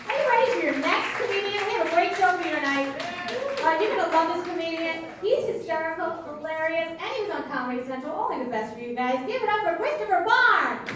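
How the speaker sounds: loud, high comedy-club introduction; show host announcer. Real, not acted. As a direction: build the crowd up for the next act.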